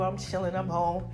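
ordinary talk, nothing marked

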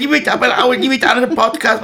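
put-on high, whining toddler voice; whining child. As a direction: whine it like a child having a tantrum in a supermarket. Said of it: "hochroten Grin gehabt und sagt". (quengelndes Kind)

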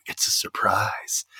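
slow, whispered tease; teasing mock whisper. Man luring her upstairs with a secret, coy whisper. Retelling a man's flirty line.

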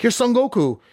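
high, put-on minion voice; henchmen. Henchmen waiting for Goku spot him, high mock minion voice. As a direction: call it out high like a startled lackey.

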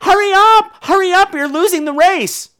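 put-on very high child's shout; high, urgent kid's yell; yelling little girl. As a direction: imitate a small girl urging her friend on. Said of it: "yells to the one who's skipping".

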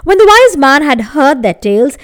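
plain reading voice

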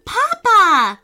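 high, loud delighted yell for his father; excited scream. A narrator's reading. Little fox screams as papa comes home, high and loud. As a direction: shout it as he leaps off his chair. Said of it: "screamed Charlie".

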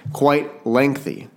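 quite lengthy drawn out slowly, quite stressed; slow mock literary "Quite lengthy"; mock author emphasis. Shown as a demonstration. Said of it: "this guy's an author".

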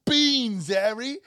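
very high, squeaky mock Ron voice; mock the whiny boy wizard; mocking Ron Weasley. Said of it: "making fun of Ron Weasley going".

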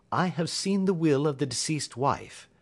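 Holmes reporting. Acted. Holmes reports to Watson on the will, precise.

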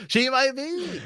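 slow, high laughing reply. Real, not acted. Host agreeing with the co-host's joke, laughing. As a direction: laugh the words out. Joking about Cersei, laughing.

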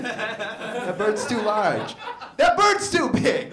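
slow, high, drawn-out protest; mock indignant outcry. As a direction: protest like an obsessed bird fan. Bird enthusiast's acted protest.

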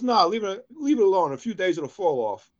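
mock urgent doctor; punchline shout. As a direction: bark the punchline out.

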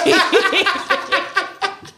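a quick high giggle. Real, not acted. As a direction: laugh at yourself. Laughs at her own joke.

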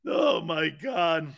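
usual speaking voice

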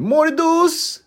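put-on loud, very high-pitched kid shriek; screaming toddler imitation. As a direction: screech it like a toddler banging on the door. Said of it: "banging the door and screaming".

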